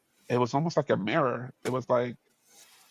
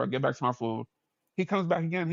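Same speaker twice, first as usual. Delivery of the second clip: ordinary talk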